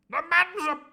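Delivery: very high loud pompous declaration; the Squire. Acted. The Squire starting his declaration, very high and loud. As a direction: declare it grandly. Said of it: "declared the Squire".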